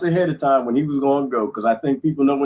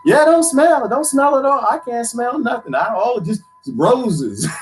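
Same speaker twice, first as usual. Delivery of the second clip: mock sweet lie; 9 semitones higher than usual. Pretending to love the smell to his future father-in-law, hammed-up. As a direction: fake delight through gritted teeth. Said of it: "And I was just like".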